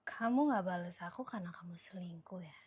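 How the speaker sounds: very soft, unvoiced whisper; hushed, low accusing whisper; whispered accusation. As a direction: whisper the suspicion to yourself. Alone, accusing absent boyfriend.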